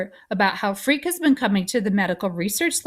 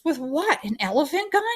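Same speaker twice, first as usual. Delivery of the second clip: higher-pitched sarcastic question; incredulous cop; 6 semitones higher than usual. A narrator's reading. Cop mocking the plan to medicate the boy, incredulous. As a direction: scoff at the idea, pitch climbing.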